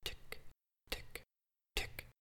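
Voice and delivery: very soft whispered ticks; ticking clock. Acted. Poet winding the clock on his enemies, whispered ticks. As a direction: tick like a clock, barely audible.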